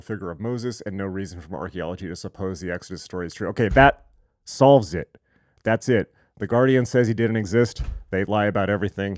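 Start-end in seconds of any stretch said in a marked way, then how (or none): 3.5-9.2 loud, sarcastic proclamation; mock-triumphant declaration. Speaker mocks The Guardian's verdict, loud and sarcastic. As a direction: declare it loudly as if the debate is over.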